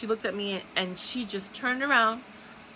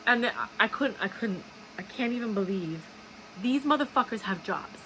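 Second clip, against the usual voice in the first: ordinary talk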